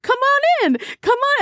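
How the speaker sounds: high, gushing pet-lover voice; cooing welcome. Pretend. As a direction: coax the new pet inside, playfully high. Welcoming a pretend pet creature.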